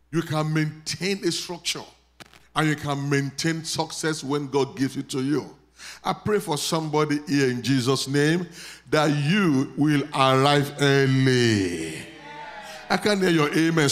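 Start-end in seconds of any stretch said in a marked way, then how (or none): 6.0-13.9 loud, ringing prayer over the crowd; preaching at full force. Acted. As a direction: proclaim it like a revival preacher.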